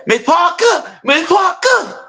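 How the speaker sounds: high, slow shouted call of Miss Parker. Retold or imitated. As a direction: holler her name across the yard. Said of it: "he over there like".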